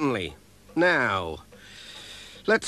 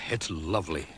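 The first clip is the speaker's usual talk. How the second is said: soft, low and contented; warm; 10 semitones lower, 9 dB quieter than usual. A narrator's reading. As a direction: sigh it out, cosy by the fire. Warming by the stove.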